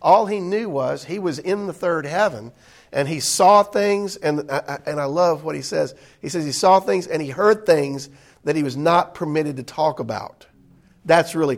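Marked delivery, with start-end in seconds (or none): none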